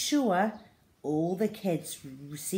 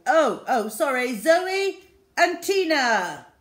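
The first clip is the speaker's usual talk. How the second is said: loud, higher, slow flustered headmaster; flustered correction; 8 semitones higher, 10 dB louder than usual. A narrator's reading.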